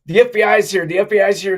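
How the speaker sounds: half-shouting the news like his frantic wife; retold yelling. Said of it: "I hear her yelling".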